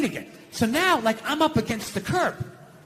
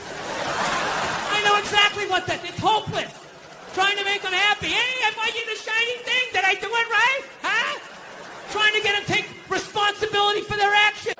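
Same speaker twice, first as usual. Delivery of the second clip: exasperated rant; 10 semitones higher than usual. Retold or imitated. Ranting about living with women.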